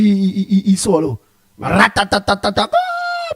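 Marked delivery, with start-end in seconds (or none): 1.6-2.7 早口でまくしたてる真似; rapid-fire nonsense syllables; mock rapid gibberish. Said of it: "is a dictionary". 2.7-3.3 startled high squeak. Real, not acted. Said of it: "I can't understand that".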